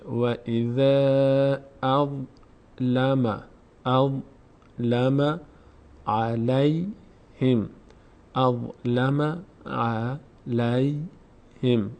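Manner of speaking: و اذا اظلم علیهم recited very slowly, vowels stretched; slow Quranic recitation. Shown as a demonstration. Teacher recites the verse slowly for students. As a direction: stretch every madd like a reciter. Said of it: "مد هم داره باید کشیده بشه".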